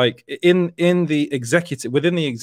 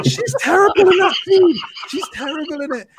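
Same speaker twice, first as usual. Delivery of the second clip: outraged rant; 3 dB louder than usual. Real, not acted. Ranting about a film scene.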